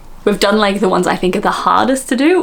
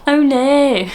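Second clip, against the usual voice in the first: high, exaggerated Aussie vowels; hammed-up Australian, pitched high; Australian accent; 7 semitones higher, 4 dB louder than usual. Vlogger mocking her Belfast slip, broad Australian. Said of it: "like Australian".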